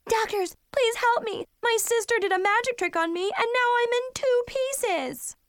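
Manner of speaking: high, hammed-up cry for help; panicked plea. Patient imagines begging emergency doctors, mock panic. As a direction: beg frantically, wailing the ending.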